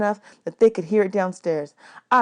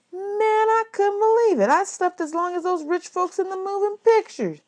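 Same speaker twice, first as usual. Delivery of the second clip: put-on astonished boy; 12 semitones higher than usual. A narrator's reading. Narrator astonished at sleeping late.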